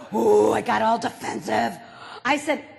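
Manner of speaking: normal talking voice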